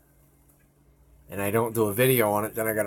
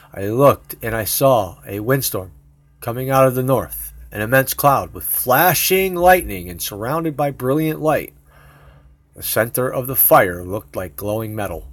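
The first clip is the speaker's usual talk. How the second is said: scripture reading; 2 semitones higher, 6 dB louder than usual. Acted. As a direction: read it like a preacher at the pulpit. Reads Bible verse aloud.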